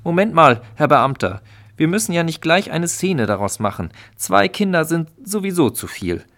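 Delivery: cowardly husband placating the official; flustered call. Acted. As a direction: fidget and plead for calm. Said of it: "hielt sich das Männchen zappelnd beiseite und rief".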